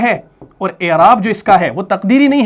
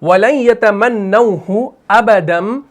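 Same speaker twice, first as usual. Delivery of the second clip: Quran recitation. Acted. Teacher recites the verse once more.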